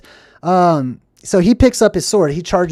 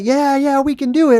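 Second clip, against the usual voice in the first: much higher eager mock voice; squeaky rallying cheer; followers cheering; 9 semitones higher, 4 dB louder than usual. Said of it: "All the other people follow".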